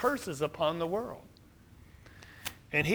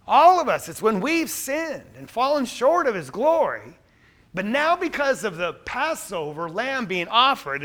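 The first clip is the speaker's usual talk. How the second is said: full-force preaching; 3 semitones higher, 5 dB louder than usual. Acted. Preacher proclaims paradise restored to congregation, full-force preaching. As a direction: drive it home to the congregation.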